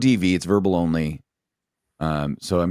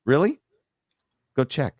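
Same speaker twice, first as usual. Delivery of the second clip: high-pitched doubting challenge; skeptical retort; 7 semitones higher than usual. Retold or imitated. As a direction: retell a pointed, doubting comeback.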